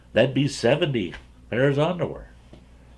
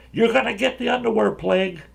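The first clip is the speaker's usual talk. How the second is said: high-pitched mock yell of a kid; retold warning cry, pitched way up; imitated kid's yell; 13 semitones higher than usual. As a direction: call it out high, like a kid across the cabin. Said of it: "he just yells across the cabin".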